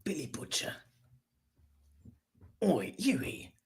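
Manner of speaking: soft put-on Cockney; hushed mock-Cockney impression; Cockney accent. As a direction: quietly play Billy the Butcher in Cockney. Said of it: "the Cockney accent".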